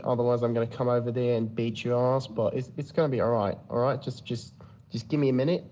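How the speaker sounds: Aussie officer fumbling through a prank call; Australian police officer. Acted. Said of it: "I did an Australian police officer".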